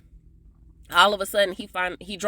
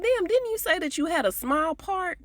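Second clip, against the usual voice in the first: high, mock-astonished question; mock surprise; 11 semitones higher than usual. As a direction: act amazed at how many lines. Speaker quotes own surprised reaction.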